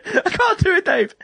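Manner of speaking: very high, whiny despair; mock crying wail. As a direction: wail helplessly to a friend, hammed up. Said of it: "rolling around crying".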